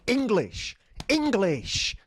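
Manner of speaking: high, stretched call of the word; insistent demand. Retold or imitated. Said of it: "like that".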